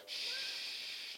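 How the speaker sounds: long, soft, steady "shh"; softly sustained lulling shush; soothing shush. Retold or imitated. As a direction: hush the baby gently. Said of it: "and I'm going".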